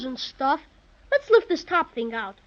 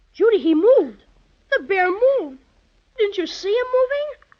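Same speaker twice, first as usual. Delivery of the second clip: excited alarm; 4 semitones higher, 6 dB louder than usual. Acted. Boy sees toy bear move.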